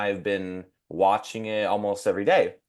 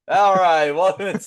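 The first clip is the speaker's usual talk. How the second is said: mock host opening, higher and louder; imitating podcast host; 8 semitones higher, 6 dB louder than usual. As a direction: lightly play the cheerful host. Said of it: "the first thing I see is".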